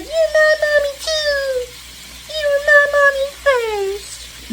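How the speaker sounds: high, slow, whiny fake crying; jealous son voice. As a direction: play the jealous pet whimpering for mom. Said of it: "in that baby talk voice".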